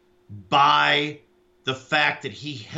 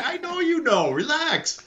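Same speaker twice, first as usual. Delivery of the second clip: mock reassuring; 12 semitones higher than usual. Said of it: "I'm like".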